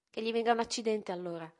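ribatte a voce alta, sfrontata; sfida ribelle. A narrator's reading. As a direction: butta lì la maledizione con sfida. Figlia ribelle risponde. (sfida della figlia alla madre, a voce alta)

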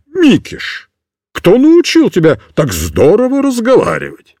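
put-on an old soldier who has seen everything; amazed old sergeant. A narrator's reading. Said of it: "вахмистр произнёс".

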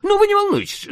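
a lightly voiced Soviet official; character voice. Character voice in audiobook.

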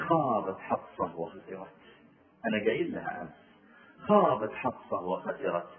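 low, soft, slow lament; quiet sorrowful sigh. Retold or imitated. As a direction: mourn her loss under your breath. Omar laments his daughter.

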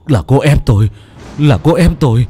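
loud frantic stammering denial; panicked excuses. A narrator's reading. Old man panicking over Lành's body, denying loudly.

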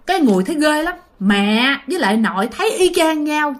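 usual speaking voice

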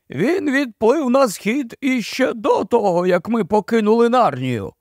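gloomy marsh-wiggle. A narrator's reading.

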